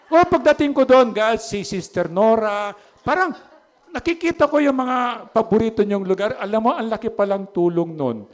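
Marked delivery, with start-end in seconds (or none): 0.1-6.3 laughing aside. Real, not acted. Laughing at members' favorite seats.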